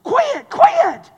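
acted goading, climbing in pitch with each word; drill instructor. Drill instructor urging a recruit to quit, goading. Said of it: "that drill instructor tell him".

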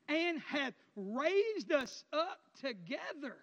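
drawn-out, weighty reading; slow scripture reading. Acted. Preacher reading verse six aloud to the congregation. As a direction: lay each word down slowly.

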